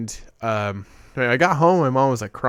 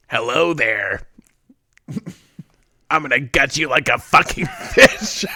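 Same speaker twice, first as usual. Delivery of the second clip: creepy prank voice; 3 dB louder than usual. Retold or imitated. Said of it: "and I said".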